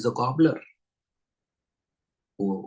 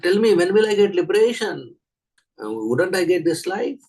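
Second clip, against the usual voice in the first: high, louder, pressing question; acting out an impatient devotee; eager questioning; 9 semitones higher, 6 dB louder than usual. Brahmana eagerly asks about liberation.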